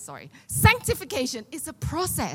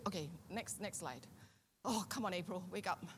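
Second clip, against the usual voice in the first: much softer, off-mic word to the slide operator; quiet aside; 5 semitones lower, 17 dB quieter than usual. Real, not acted. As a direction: murmur it aside to the tech, not the crowd.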